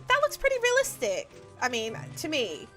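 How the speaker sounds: ordinary talk, nothing marked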